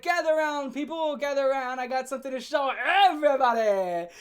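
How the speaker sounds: calling out. Retold or imitated. Calling students to the gym cage, loud. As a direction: summon the crowd like a barker.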